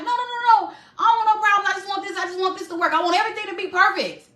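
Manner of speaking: put-on high, pleading no no no as her anxious old self. To her live viewers, mimicking her old people-pleasing self, high and pleading. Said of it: "I was that person that always like".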